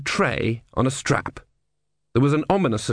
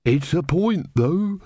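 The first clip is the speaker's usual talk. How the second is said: boring unstoppable voice; 7 semitones higher, slower than usual. A narrator's reading. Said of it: "in a kind of boring, unstoppable voice".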